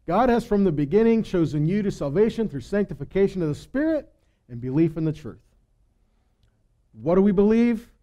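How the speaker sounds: building sermon fervour; preaching. Acted. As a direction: drive the point home to the congregation. Preaching at full force, rising.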